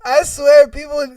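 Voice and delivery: ordinary delivery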